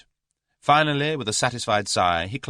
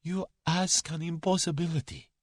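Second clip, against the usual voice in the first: frightened refusal; 12 semitones higher than usual. A narrator's reading. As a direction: shrink from answering. Cornered coward refuses again.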